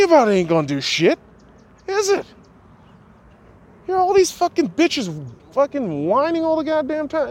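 high, loud screaming rant; furious rant. Real, not acted. Ranting at complaining viewers, in a high furious scream. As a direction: rip into the whiners at full pitch.

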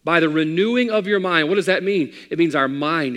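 loud, high preaching cry; full-force preaching. Acted.

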